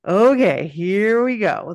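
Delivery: loud opening, then drawn-out build-up; bracing excitement. Real, not acted. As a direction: brace yourself out loud for the big moment. Bracing to tell the big moment.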